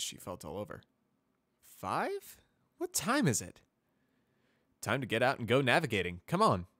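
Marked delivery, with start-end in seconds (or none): 1.6-3.6 put-on sleepy young mare. Groggy mare just woken early. 4.8-6.7 cheerful wake-up call. Acted. Cheerful stallion rousing her.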